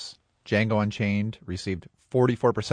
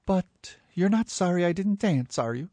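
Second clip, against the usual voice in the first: higher, lightly hurt retort; acted young woman, mildly hurt; wounded dancer; 7 semitones higher than usual. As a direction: push back at him, a little stung. Acted film scene, hurt dancer.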